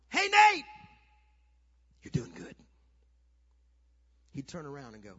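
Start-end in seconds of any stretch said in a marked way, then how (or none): 0.1-0.6 high, loud shout from the stands; scream. Retold or imitated. Dad in the stands calls to benched son, screaming. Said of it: "I'd scream out". 2.0-2.6 whispered cheer to your son; hushed encouragement. Retold or imitated. Dad encourages son on the sidelines, whispering. Dad's encouragement after shouting.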